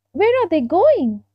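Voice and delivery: high, small, curious voice; curious little fir tree. Acted. Little fir tree asking the stork, high and curious. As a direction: ask it high like a curious youngster.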